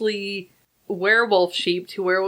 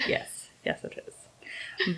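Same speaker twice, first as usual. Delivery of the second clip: whisper; 3 semitones lower, 12 dB quieter, slower than usual. Pretend. Spooky Halloween banter.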